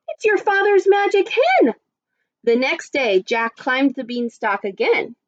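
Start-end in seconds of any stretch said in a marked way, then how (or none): 0.1-1.7 high-pitched, wondering mother; amazed exclamation. Acted. Mother recognising the magic hen, amazed exclamation. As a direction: exclaim it high in delighted surprise. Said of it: "she exclaimed".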